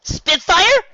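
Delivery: high, loud startled cry of a name; shocked question. Acted. Rainbow Dash sees Spitfire, shocked high cry. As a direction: call out in wide-eyed disbelief. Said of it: "she asked, eyes wide".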